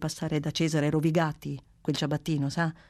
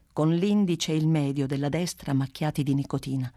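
ordinary delivery, nothing marked

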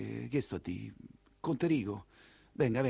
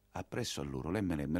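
normal talking voice

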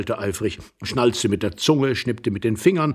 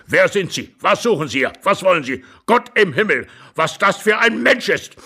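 put-on raised, indignant old man; agitated, higher-pitched demands; angry interrogation; 9 semitones higher, 4 dB louder than usual. A narrator's reading. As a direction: fire off outraged questions.

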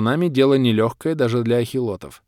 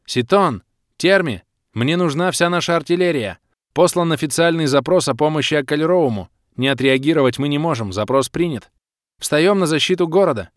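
high, raised commanding voice; shouted orders; 6 semitones higher, 4 dB louder than usual. A narrator's reading. Clan head rallying everyone to defend the city, shouting. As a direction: call out orders urgently. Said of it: "крикнула".

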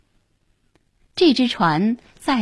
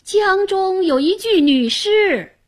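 high-pitched boatman's alarm call; loud shout; 11 semitones higher than usual. A narrator's reading. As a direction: call it out across the water. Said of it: "忽听一船夫高喊".

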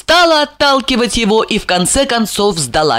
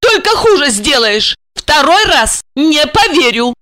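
high-pitched, indignant warning; snapping at him; 8 semitones higher than usual. Acted. Wife warns her husband against denying it, snapping. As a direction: scold in a raised woman's voice. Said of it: "прикрикнула она".

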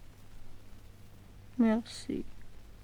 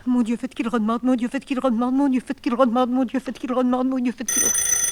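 a higher, urgent murmured litany; desperate prayer; 5 semitones higher, 3 dB louder, faster than usual. Acted. Woman begging God for her lover to call back, urgent litany. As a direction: pray it over and over, begging.

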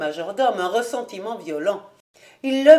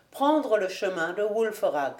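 ordinary delivery

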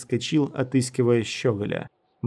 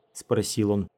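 usual speaking voice

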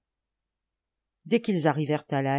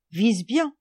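high, loud recommendation; urging advice; 6 semitones higher, 5 dB louder than usual. A narrator's reading. Lebrac advising the boy with the slingshot, urging. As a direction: urge care before the shot. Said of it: "recommandait Lebrac".

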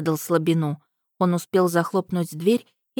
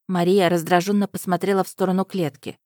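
ordinary delivery

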